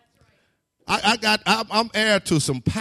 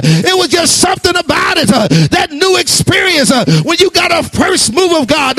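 loud, high, building sermon; full-force preaching; 8 semitones higher, 15 dB louder than usual. Acted. Preacher stirring the congregation, loud and fervent. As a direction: preach it out to the church.

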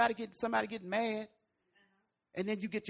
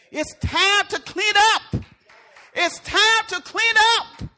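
high, loud, drawn-out command; emphatic shout; 9 semitones higher, 7 dB louder, slower than usual. Acted. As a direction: belt it out slowly to rouse a congregation. Repeats the command, raising voice.

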